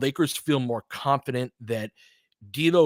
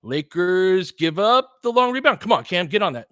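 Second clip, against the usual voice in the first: higher, louder, stretched-out call; excited urging; 6 semitones higher, 5 dB louder than usual. Real, not acted. Watching the Lakers game, urging Cam on excitedly. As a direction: yell at Cam to chase the ball.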